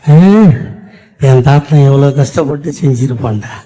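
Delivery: ordinary talk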